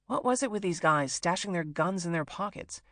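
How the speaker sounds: ordinary delivery, nothing marked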